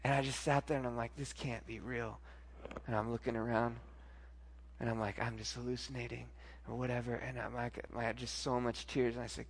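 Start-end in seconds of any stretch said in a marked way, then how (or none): 1.2-2.2 very soft, low, slow murmur; hushed retold disbelief; stunned disbelief. Stunned after the angel's kiss. 5.2-7.1 low, very soft murmur; hushed retold self-talk; self-doubt. Retelling private doubt after the vision.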